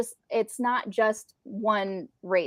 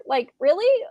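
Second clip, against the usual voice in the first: incredulous; 9 semitones higher, 4 dB louder than usual. Real, not acted. Outraged at 2020 book version.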